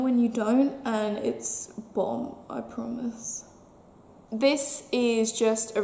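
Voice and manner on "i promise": a soft, voiceless aside; whispered promise. Real, not acted. Reassuring her viewers in a whispered aside. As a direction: whisper it to the viewer like a little secret.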